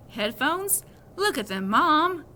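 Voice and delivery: loud indignant outburst; angry. Acted. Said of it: "I said angrily".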